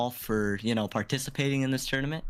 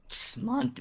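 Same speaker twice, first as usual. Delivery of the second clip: voice crack; 12 semitones higher than usual. Real, not acted. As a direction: voice cracks up high. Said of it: "Did you guys hear that voice crack?".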